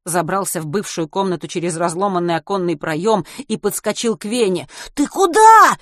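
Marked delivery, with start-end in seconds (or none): 4.9-5.8 very high and very loud; frantic scream. A narrator's reading. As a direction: shriek in panic. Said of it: "истошно закричал тот".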